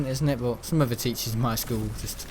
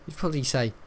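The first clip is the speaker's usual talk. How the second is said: ordinary delivery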